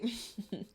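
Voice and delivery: short high giggle; small amused chuckle; laugh. Real, not acted. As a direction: giggle briefly at your own outburst. Laughs after own outburst.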